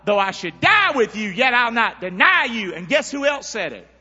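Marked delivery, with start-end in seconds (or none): 0.0-2.7 Peter's vow. Retold or imitated. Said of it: "Peter said".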